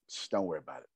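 hushed, low mobster voice; mob tone. Retold or imitated. Imitating Ken settling an upset friend, mob tone. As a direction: quietly shush them like a mobster. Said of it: "that's that mob tone".

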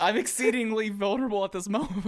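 high, strained plea of vulnerability; squeaky comic indignation; mock-wounded protest. Real, not acted. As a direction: protest pitifully, pitched right up. Teased, protests being gaslit.

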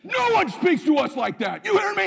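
high, loud laughing yell; fired-up shout. Retold or imitated. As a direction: pump him up with a laughing roar.

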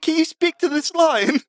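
high, eager in-character call. Acted. Asking Scar whether he speaks to the lion, high character voice. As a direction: pitch it way up, hammed-up amazement.

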